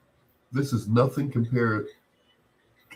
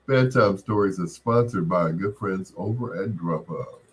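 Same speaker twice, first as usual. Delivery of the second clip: sponsor read; 2 semitones higher, 3 dB louder than usual. Acted. DJ reads sponsor message.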